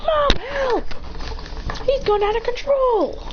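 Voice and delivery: high, drawn-out wail to mother; panicked cry for help. Acted. Children running to Hinata, high panicked cry for help.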